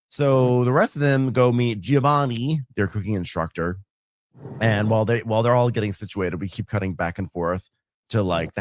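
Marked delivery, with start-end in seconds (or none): none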